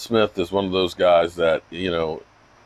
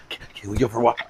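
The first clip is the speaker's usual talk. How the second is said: puppy dog voice; 2 semitones higher, much faster than usual. Retold or imitated. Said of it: "I'm like a puppy dog".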